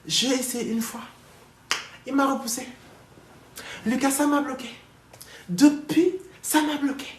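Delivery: put-on very high, loud Senegalese-accented complaint, escalating. Speaker mimics wife repeating her excuse, wailing Senegalese accent. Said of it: "je prends l'accent sénégalais".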